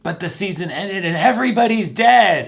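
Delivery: high, loud mock voice; acted quote. A playful imitation of a quote from the show, loud and high. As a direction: pitch it way up, playful.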